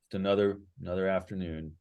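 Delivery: low, soft and dejected; downcast, fading away softly; sad bard. Acted. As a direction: sink into a quiet, glum performance. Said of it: "he looks kinda sad".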